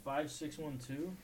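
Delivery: barely audible, counting under breath; muttering to himself. Real, not acted. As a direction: mumble the numbers to yourself. [name] mutters betting numbers.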